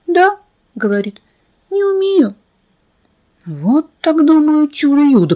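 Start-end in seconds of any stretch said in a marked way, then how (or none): 0.1-0.4 high, thin little yes; a squeaky little girl; tiny girl voice. A narrator's reading. Fenka answering the narrator, thin high voice. 1.7-2.3 put-on high, thin little girl's voice; tiny girl voice. A narrator's reading. Fenka insisting to the narrator, thin high voice.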